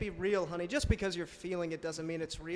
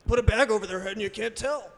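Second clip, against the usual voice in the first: loud quip; 7 dB louder than usual. Real, not acted.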